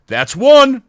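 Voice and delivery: very high, loud playful verdict; mock stern warning. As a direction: call the strike, half joking. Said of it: "I'm like".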